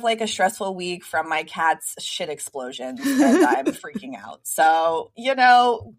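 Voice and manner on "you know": ordinary talk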